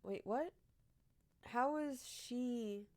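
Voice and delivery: normal talking voice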